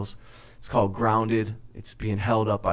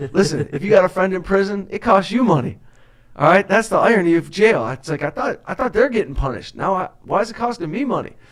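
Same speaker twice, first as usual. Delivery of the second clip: excited rant; 8 semitones higher, 5 dB louder than usual. Real, not acted. Ranting about prison costs.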